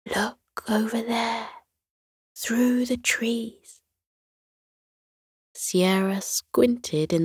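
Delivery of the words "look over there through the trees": soft unvoiced whisper, pointing something out; breathy secret whisper, slow on through the trees. A narrator's reading. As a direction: hush it so the creature won't hear. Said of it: "whispered to Sierra".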